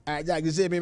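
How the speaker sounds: ordinary talk, nothing marked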